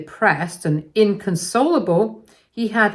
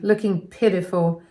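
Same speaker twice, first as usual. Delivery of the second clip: usual speaking voice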